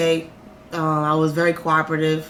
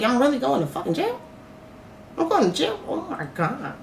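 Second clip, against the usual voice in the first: high voice dropping to a soft end; retold distressed disbelief, trailing away; dismayed realization; 6 semitones higher, 5 dB quieter than usual. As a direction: let it sink in with rising dread. Retold dread of going to jail.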